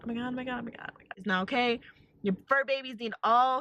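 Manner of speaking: usual speaking voice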